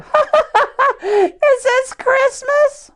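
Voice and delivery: laugh, then ask with delight; giggling baby hippo wondering aloud; giggly little hippo. A narrator's reading. Fiona snorts and laughs in story.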